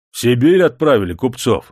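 excited coachmen's cry; coachmen shouting. A narrator's reading. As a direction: call out the news loudly. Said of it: "закричали ямщики".

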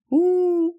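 high sulky moan; disappointed whine. Acted. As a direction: deflate audibly. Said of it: "скисла я".

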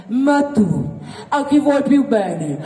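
ordinary delivery

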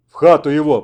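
loud croaked command; hoarse order. A narrator's reading. Sick Dryomenko orders the wounded man indoors, hoarse order. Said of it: "прохрипел он".

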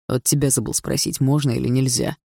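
ordinary delivery, nothing marked

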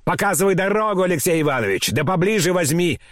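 high-pitched bossy orders; commanding. A narrator's reading. Grandmother directing Alexei along the road, high and commanding. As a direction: direct the way like an old matriarch in charge. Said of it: "командовала бабушка".

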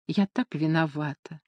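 soft, sorry little voice; apologetic whisper. A narrator's reading. Люсинда шепчет лорду извинения, тихо и виновато. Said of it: "извиняющимся тоном шептала она".

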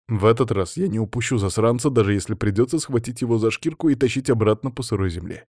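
plain reading voice

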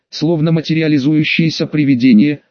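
ordinary delivery

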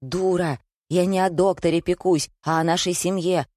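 ordinary delivery, nothing marked

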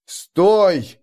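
sharp call to stop; shout. Acted. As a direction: yell after her. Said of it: "крикнул я".

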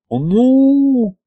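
long high hesitant "nu"; drawn-out "well". A narrator's reading. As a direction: stretch the word out. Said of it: "протянул Витарамус".

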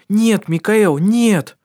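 high and loud, fervent; fervent exclamation. A narrator's reading. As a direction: cry out with passion. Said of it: "горячо воскликнула она".

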